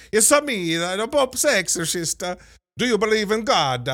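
high, loud hammed-up Italian priest accent; mock Russell Crowe's cartoonish Italian accent; over-the-top fake Italian accent, pitched high; bad Italian accent. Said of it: "Father Guido Sarducci level ... accent work".

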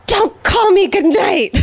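high, slow imitated drunk yell; cranky yell. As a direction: bark it like a grumpy old drunk. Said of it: "yells after ... crazy guy goes".

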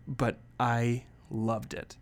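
normal talking voice

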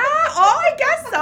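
very high, loud squeal of delight; real excited shriek on the words; delighted outburst. Real, not acted. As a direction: burst out high and loud, thrilled. Delighted reaction to being teased.

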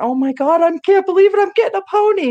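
very high-pitched acted-out squeal of excitement; overjoyed child. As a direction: play a kid who thinks her wish came true. Child believing she gets pony.

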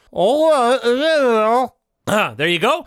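talking with a phone in the mouth; phone held in teeth. Real, not acted. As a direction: mumble around a phone between the teeth. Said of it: "you really wanted me to put my phone in my mouth".

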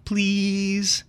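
lightly voiced child asking nicely; toddler saying please. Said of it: "she'd say".

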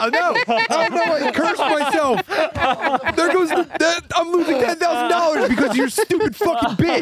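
put-on very high-pitched, laughing Indian-accent lament. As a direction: wail in mock dismay with an Indian accent. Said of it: "with the Indian accent".

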